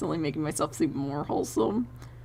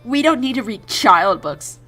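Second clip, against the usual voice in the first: louder, hammed-up child boasting; drama queen child; 2 semitones higher, 8 dB louder than usual. Drama queen child refusing kid books, hammed-up. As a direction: imitate a self-important kid protesting. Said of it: "drama queen guy".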